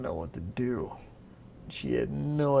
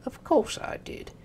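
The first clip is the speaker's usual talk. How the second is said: much higher, snapping imp; 12 semitones higher, 4 dB louder than usual. Acted. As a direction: snap at her impatiently. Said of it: "snapped the imp".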